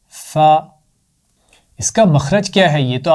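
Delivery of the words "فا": فا short and clear, just the sound. Shown as a demonstration. Teacher modelling the letter sound, louder. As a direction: give the quick sound فا. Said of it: "اور یہ ہے فا کی آواز۔".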